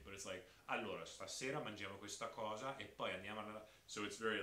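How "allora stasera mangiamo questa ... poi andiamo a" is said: brisk, even Milan Italian; staccato northern Italian from Milan; choppy Milanese accent. Acted. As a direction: chop it up like a Milanese. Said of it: "it's very, almost choppy".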